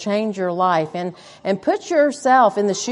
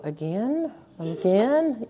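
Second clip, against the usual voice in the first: muttered under breath; 7 dB quieter, slower than usual. Retold or imitated. Traveler seeing flight delayed again, soft grumbling mutter. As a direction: sigh it out under your breath. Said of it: "under your breath".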